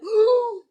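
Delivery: sharp mock gasp of shock. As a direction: gasp like a scandalized girl.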